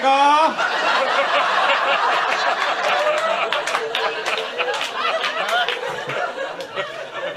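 long high breathy laugh; big laugh. Real, not acted. Comic laughing hard at own impression, high. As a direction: crack up at your own bit.

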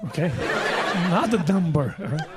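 ordinary delivery

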